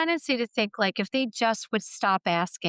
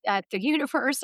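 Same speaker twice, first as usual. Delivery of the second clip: normal talking voice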